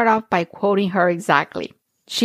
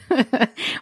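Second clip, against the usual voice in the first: short laugh; 5 semitones higher than usual. Real, not acted. Laughs at past frustration.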